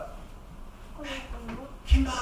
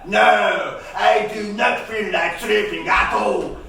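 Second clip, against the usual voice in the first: put-on stubborn kid yelling; 4 semitones higher, 7 dB louder than usual. Boy refusing bedtime.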